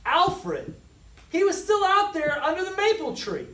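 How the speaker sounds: high, loud, fast panic; sudden alarm for a lost toy; frantic child realizing; panicked cry. A narrator's reading.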